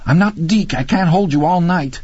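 high, urgent protest; acted desperate refusal; strained exasperation. A narrator's reading. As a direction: insist under physical strain. Man strains holding woman up.

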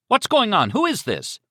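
high, urgent cry; Beaumont crying out. Acted. Man on phone hears trouble, crying out. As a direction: demand answers, loud and alarmed. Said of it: "Beaumont cried".

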